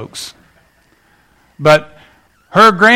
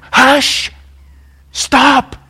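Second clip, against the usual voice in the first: commanding hush; 8 semitones higher than usual. Retold or imitated. Samuel silencing Saul, commanding and higher. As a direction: cut him off hard. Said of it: "this is what Samuel".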